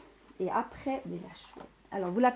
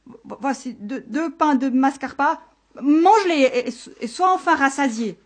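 high stuttering shepherd, growing louder; terrified stammer; 5 semitones higher, 9 dB louder than usual. Acted. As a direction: stammer in fright, then blurt it loudly. Terrified shepherd offers cheese to monster. (bégaiement apeuré, voix aiguë)

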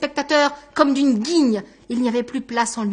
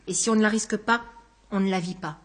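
usual speaking voice

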